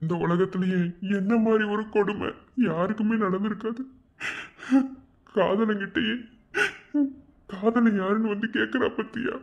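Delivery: high-pitched, halting self-pity; pained inner monologue; anguished lament. Acted. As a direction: lament your cruel luck to yourself. Laments being asked to find himself.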